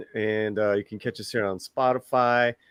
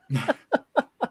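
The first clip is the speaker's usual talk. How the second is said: high laugh; 12 semitones higher than usual. Real, not acted. Laughing at own Spotify joke.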